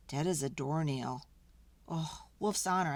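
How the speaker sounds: usual speaking voice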